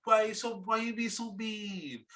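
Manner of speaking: high, slow, drawn-out crybaby moan; hammed-up self-pitying whine; mock whining. Host mocks the sulking player after his rant, whining high.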